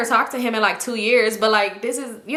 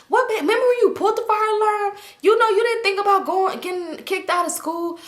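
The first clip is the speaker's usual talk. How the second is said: mock nagging classmate; 10 semitones higher than usual. Boy pestering her about past.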